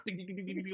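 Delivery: high, loud mock bell ringing; ding it out like a desk bell. GM imitating the desk bell being smacked, loud high dings. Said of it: "she goes".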